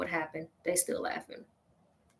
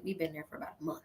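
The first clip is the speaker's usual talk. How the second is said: whispered aside; 5 semitones lower, 15 dB quieter, much faster than usual. Real, not acted. Story setup dropped to whisper.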